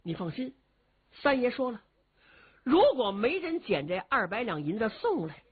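ordinary delivery, nothing marked

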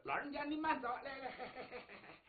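high, soft voice with chuckling; fawning son-in-law. Acted. Son-in-law escorting his new father-in-law, fawning chuckle. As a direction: coax deferentially, then giggle softly. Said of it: "土行孙还挺孝顺".

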